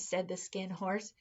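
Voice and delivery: usual speaking voice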